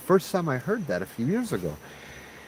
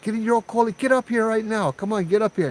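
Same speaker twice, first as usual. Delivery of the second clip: high-pitched call down to the river; 9 semitones higher than usual. Retold or imitated. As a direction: yell for her to come up, pitched much higher. Calling down to girl at river.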